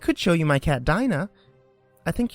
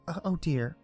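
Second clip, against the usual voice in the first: sorrowful tone; 3 semitones higher, 8 dB quieter than usual. A narrator's reading. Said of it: "Alice cried in a sorrowful tone".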